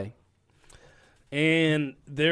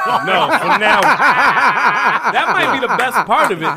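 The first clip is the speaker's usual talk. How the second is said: high, slow words squeezed out through laughter; laughing hard; 8 semitones higher, 7 dB louder, much slower than usual. Real, not acted. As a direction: laugh your way through the line. Laughing at the mask joke.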